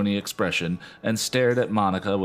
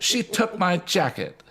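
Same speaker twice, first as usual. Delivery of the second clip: angry; 11 semitones higher than usual. Acted. Bridget insisting.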